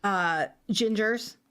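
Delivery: low, slow mocking drone as the showrunner.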